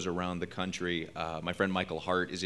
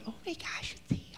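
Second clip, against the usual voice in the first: excited whisper; 10 dB quieter than usual. Retold or imitated. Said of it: "people just answering phone banks going".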